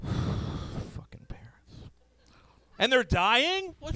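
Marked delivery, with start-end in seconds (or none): 0.0-1.9 slow, weary whispered curse. Acted. Comic muttering after the rant, whispered. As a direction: breathe it out, spent.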